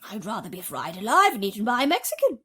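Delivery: wailing. A narrator's reading. Grasshopper wailing to the others, fast and loud. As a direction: rush out the lament. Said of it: "wailed the old green grasshopper".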